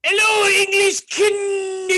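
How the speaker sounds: high, shouted mock French accent; French guy from Monty Python. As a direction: yell a drawn-out taunt in cartoon French. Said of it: "sounded like the French guy".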